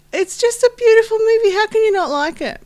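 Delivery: high, loud gushing praise. Real, not acted. Repeats praise, much more gushing.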